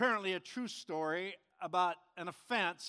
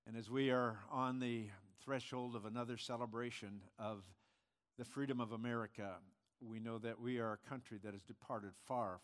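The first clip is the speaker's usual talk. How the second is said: low, soft, measured praying voice; pastoral prayer; 7 semitones lower, 7 dB quieter than usual. Real, not acted. Pastor praying for the country before the congregation, hushed prayer. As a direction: pray quietly, sinking lower and softer into the amen.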